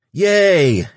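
high, loud squeal of delight; excited cheer. A narrator's reading. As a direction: cheer like a thrilled kid. Antonio cheers, clapping.

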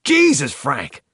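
appalled cry; exasperated exclamation. A narrator's reading. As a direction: burst out in exasperation, pitch way up. Tex exclaims after his shouted outburst.

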